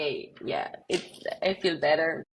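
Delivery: ordinary delivery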